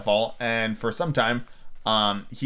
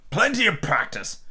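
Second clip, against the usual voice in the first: knight voice; 6 semitones higher, slower than usual. Shown as a demonstration.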